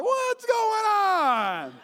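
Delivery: very high, very slow holler; imitated clueless greeting. Hard-of-hearing man answering unseen caller, mock holler. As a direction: stretch every word into a long call.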